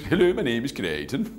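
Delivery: high, lilting Swedish comedy voice; sing-song Swedish accent. Shown as a demonstration. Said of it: "had the sing-song voice".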